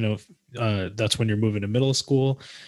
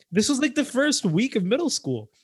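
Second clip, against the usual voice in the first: indignant outburst; 10 semitones higher, 4 dB louder than usual. Real, not acted. Indignant retelling.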